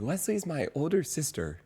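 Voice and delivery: a hint of an upbeat friend; bouncy friend. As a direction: imitate her bouncy cadence. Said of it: "how did she say it".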